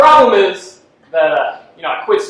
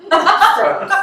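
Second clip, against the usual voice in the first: laughing; 11 semitones higher than usual. Real, not acted. Laughs at own stumble.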